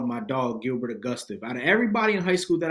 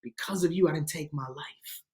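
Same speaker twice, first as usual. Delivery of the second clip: hushed, higher, sinking to a whisper; imitated tearful thanks; 4 semitones higher, 5 dB quieter than usual. Man thanking the worker who saved him, imitated tearful hush. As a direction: retell tearful gratitude, very softly. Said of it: "He said, with tears coming out".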